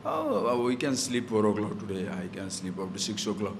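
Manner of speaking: low, easygoing self-indulgent aside; lazy excuse-making. Acted. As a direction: voice a sluggard talking himself into sleep. Acting out a lazy excuse to oneself.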